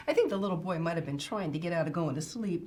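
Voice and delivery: ordinary delivery, nothing marked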